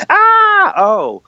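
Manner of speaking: very high, loud cry dropping to "oh"; cheer turning to letdown. Retold or imitated. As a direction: imitate a crowd's excitement suddenly deflating. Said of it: "everyone had just gone".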